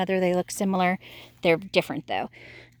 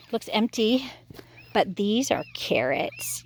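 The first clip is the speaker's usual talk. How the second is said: ordinary talk, nothing marked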